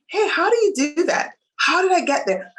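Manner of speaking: a hint of an eager student; eager question to a mentor. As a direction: play yourself asking a mentor how they did it. Asking a mentor for advice.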